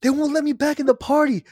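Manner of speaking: high, loud mock voice of a drunk friend; imitating drunk William. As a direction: squeal it up high like a wasted buddy. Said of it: "he said".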